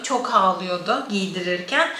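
ordinary talk

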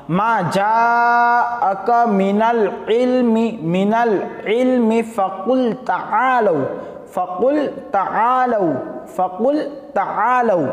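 very slow, stretched melodic recitation; slow Quranic chant with long vowels. Shown as a demonstration. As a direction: draw out every syllable like tilawat. Reciting Quran verse in class.

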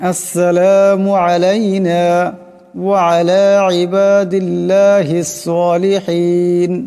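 measured, chanted recitation of the tashahhud; drawn-out devotional chant; tajweed recitation. Shown as a demonstration. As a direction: recite it slowly and melodically, as in prayer. Teacher recites next phrase.

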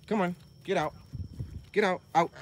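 high, sharp shooing call; shooing animals. Real, not acted. Owner shooing animals out of the goat's pen, high scolding. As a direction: chase them out with a high scold.

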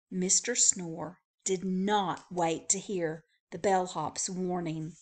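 usual speaking voice

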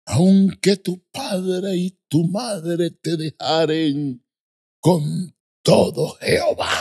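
high, slow scripture proclamation; imitated preacher. Preacher reading the verse to the village, high solemn imitation. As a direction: proclaim the verse in long drawn-out words. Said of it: "Y dijo".

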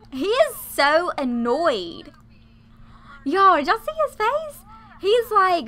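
loud, high, amazed outburst; gleeful amazement. Real, not acted. Player shows viewers his annoyed face, amazed.

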